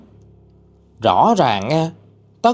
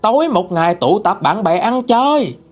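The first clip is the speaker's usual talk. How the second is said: father shouting his son out of the house; yelling father; 9 semitones higher than usual. Acted. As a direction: berate the lazy son at the top of your voice. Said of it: "tiếng của ba chửi lớn là".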